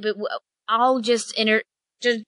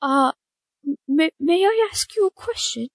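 a touch higher, timid mother bird; lightly voiced bird mother; 5 semitones higher than usual. Bird mother timidly asks the party a question. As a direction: ask hesitantly, as a worried mother.

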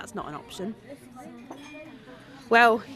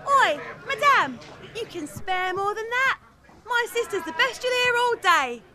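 calling out to passers-by; 9 semitones higher than usual. Acted. Sister begging the street crowd for coins, calling out. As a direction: sell the show to the crowd.

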